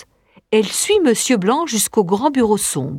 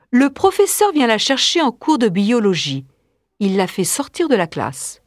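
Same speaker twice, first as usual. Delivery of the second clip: ordinary delivery, nothing marked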